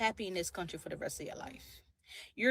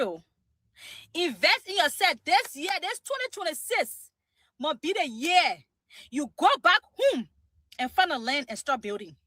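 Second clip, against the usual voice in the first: loud, high Liberian English exhortation; rally her people in a Liberian accent; 3 semitones higher, 6 dB louder than usual. Shown as a demonstration. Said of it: "my Liberian accent".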